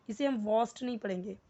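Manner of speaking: normal talking voice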